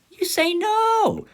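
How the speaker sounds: very high, drawn-out exclamation; emphatic mock refusal. Telling the drunk brother no, high drawn-out refusal. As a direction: stretch it out in a high comic cry.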